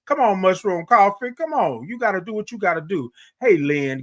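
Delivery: usual speaking voice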